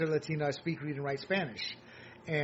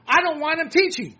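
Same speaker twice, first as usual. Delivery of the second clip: emphatic outburst; 9 semitones higher, 8 dB louder than usual. Real, not acted. Heated rant about school teachers.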